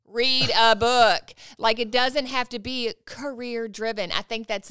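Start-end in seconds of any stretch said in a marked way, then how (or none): 0.0-1.3 slow, loud insistence; emphatic repeat. Real, not acted. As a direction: drag it out and hammer it home.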